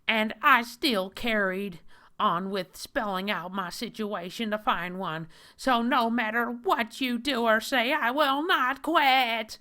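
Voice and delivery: high, loud mocking nerdy whine; hammed-up high whiny man voice. Reads the comic hero's own words.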